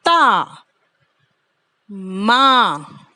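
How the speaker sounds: slow stretched माँ; long vowel. Shown as a demonstration. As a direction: hold the aa sound long. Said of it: "long sound आ रही है ना".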